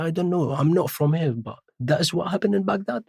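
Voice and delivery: high-pitched, innocent know-nothing act; playing dumb. Passenger answering the taxi driver, playing stupid. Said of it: "tried to play stupid".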